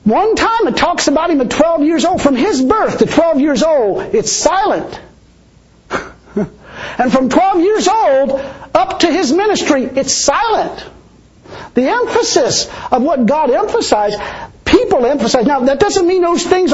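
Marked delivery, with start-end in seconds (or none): 0.1-5.0 raised preaching voice. Real, not acted. To the congregation, preaching with rising pitch. 7.0-15.5 raised preaching voice. Real, not acted. To the congregation, preaching with rising pitch.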